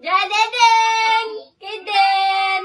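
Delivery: high, loud, drawn-out call; loud sing-song name call. Real, not acted. Calling out to relatives on call.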